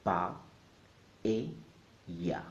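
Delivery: Pa, e, ya, each one apart; three separate syllables. Shown as a demonstration. Teacher splitting the word, Pa, e, ya syllable by syllable. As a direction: break it into Pa, e, ya with pauses. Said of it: "is in three syllables".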